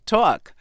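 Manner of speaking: "talk" as New York "tawk"; New York pronunciation. Shown as a demonstration. New Yorker showing her native accent, rounded "tawk" for "talk". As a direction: round the vowel of "talk" into a strong "aw". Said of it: "and we would say".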